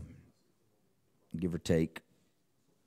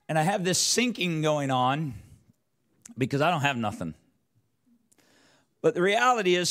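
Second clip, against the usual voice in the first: rising sermon delivery; 7 semitones higher than usual. Real, not acted. Preaching builds in pitch.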